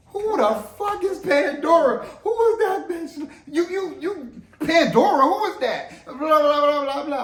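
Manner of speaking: hammed-up jealous wife yelling about Pandora; mock furious wife. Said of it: "in a typical way".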